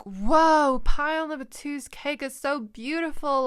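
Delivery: a loud, high burst of wonder; amazed whoa. Retold or imitated. Reader marvels at a rival's wedding cake, amazed. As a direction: gasp at the cake, then gush about it. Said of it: "I'm like".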